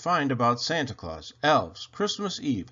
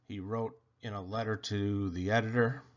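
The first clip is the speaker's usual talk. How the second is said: ordinary delivery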